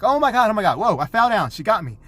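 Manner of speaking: high, loud mock fright; play-acted alarm. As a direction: fake a startled tumble, loudly. Acts falling victim in game.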